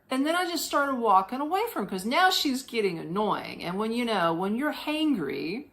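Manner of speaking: usual speaking voice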